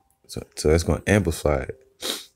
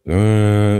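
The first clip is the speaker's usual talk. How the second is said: long droning horn blast, higher; imitated blaring horn; 4 semitones higher, 4 dB louder than usual. Shown as a demonstration. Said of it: "gonna sound like".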